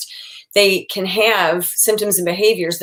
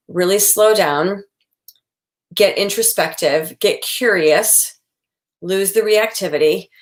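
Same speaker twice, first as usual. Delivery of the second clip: slow and calm; slower than usual. Shown as a demonstration. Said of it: "I always advise people".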